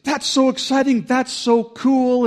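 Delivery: high, loud squeal, stretched on cool; squealing excitement. Retold or imitated. Dan's girlfriend hearing the engagement news, squealing with delight. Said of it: "is just like".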